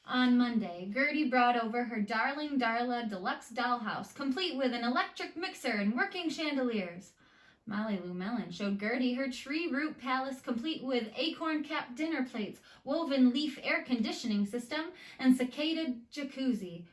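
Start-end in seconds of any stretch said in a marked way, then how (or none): none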